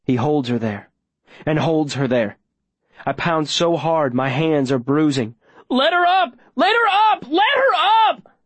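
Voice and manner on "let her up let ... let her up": very high, full-throated screaming plea; desperate yelling. Acted. Boy watching her held underwater, desperate yell. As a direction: scream it, drawing out the last one. Shouting while pounding on glass.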